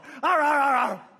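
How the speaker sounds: high, loud frustrated ah cries; straining cries. Retold or imitated. Believer straining like the barking dog, loud cries. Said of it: "barking at dog".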